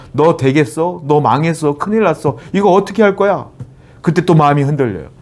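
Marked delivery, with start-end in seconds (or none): 0.1-3.5 rising, mocking questions of doubt; taunting voice of the world. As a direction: needle the listener with higher, scoffing taunts. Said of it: "세상에 또 말해요". (세상의 목소리처럼 높게 비웃듯이 몰아붙이는 말투)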